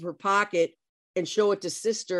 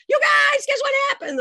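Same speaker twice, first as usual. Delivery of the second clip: very high, loud shout down the hallway; excited yell; 6 dB louder than usual. Retold or imitated. Teacher yelling to her eighth grade in the halls, excited. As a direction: yell out big news to everyone. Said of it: "I'm yelling in the halls".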